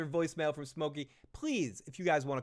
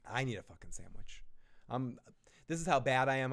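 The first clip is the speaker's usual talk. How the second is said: ordinary talk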